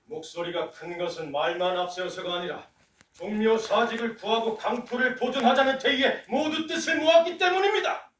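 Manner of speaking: ordinary talk, nothing marked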